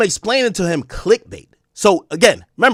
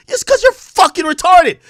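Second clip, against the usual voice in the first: higher, louder exasperated jab; exasperated insult; 12 semitones higher, 5 dB louder than usual. Retold or imitated. Host voices his intrusive thought at the rapper, in a loud exasperated jab. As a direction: throw it at him, fed up.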